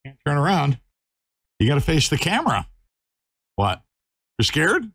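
high, quick coaxing puppy talk. Real, not acted.

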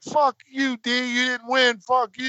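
high, sing-song jeering at the loser; mocking taunt. Neighborhood kids taunt the boy who lost, mocking jeer. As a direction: gloat like a neighborhood bully.

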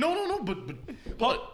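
high squeaky mock protest; mocking imitation. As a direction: mimic his flustered protesting. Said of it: "He's saying".

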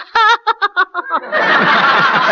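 high squawking cackle; high parrot cackle. Shown as a demonstration. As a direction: shriek a bird's laugh way up high. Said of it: "to do a parrot".

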